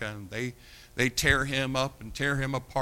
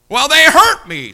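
much higher, much louder mock whine; mock whiny complainer; 13 semitones higher, 17 dB louder than usual. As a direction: ham up the hurt victim.

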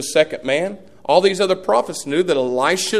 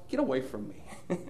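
much softer, a low brush-off; hushed mock dismissal; 4 semitones lower, 15 dB quieter than usual. Elisha to the gossiping prophets, soft dismissive brush-off. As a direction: send them away under your breath. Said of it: "And Elisha said".

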